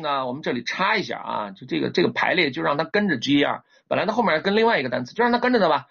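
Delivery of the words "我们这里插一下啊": ordinary delivery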